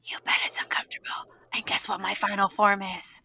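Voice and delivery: unvoiced creepy-close whisper. Pupil leaning in close to the teacher's ear, whispering. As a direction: whisper right into someone's ear. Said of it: "whispers right into my ear".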